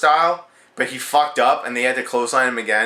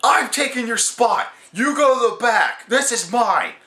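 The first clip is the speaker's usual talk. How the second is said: higher, indignant shouting as The Miz; mock angry Miz; 7 semitones higher than usual. The Miz shoves Sandow aside, mock angry. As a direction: snap at Sandow like a furious heel. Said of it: "The Miz stops and he's like".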